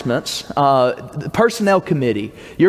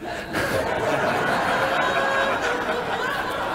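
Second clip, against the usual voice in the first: laughing; 10 dB quieter than usual. Real, not acted. Preacher laughs at own joke.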